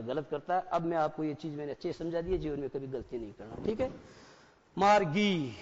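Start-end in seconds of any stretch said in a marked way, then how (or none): 4.8-5.6 "मार्गी" में जी की जगह साफ़ गी; "मार्गी" को ग की ध्वनि से बोलकर दिखाएँ; कठोर ग उच्चारण. Shown as a demonstration. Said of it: "मैं इसको मार्गी बोल रहा हूँ". ("मार्गी" में ग का उच्चारण)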